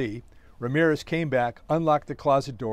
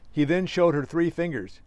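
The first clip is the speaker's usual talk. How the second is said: plain reading voice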